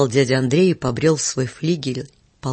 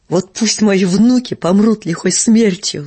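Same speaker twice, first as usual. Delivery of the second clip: louder, slow, higher frantic oath; frantic scream; 4 semitones higher, 6 dB louder, slower than usual. A narrator's reading. Marina Semyonovna curses Uncle Andrey, frantic scream. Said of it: "закричала она истошным голосом".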